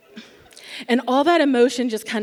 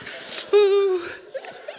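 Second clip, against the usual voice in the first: 1 shaky sigh; 9 semitones higher than usual. Retold or imitated. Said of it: "I am crying so hard".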